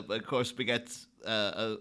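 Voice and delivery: usual speaking voice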